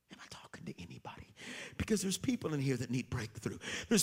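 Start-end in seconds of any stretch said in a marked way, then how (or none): 0.1-1.3 sudden hushed whisper to the crowd; a quick, fast whispered check-in. Real, not acted. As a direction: drop to a whisper and lean in. Aside to congregation.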